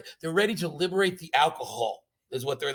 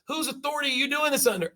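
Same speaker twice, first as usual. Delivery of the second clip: outraged commander; 8 semitones higher, faster than usual. Retold or imitated. Retelling commander's demand.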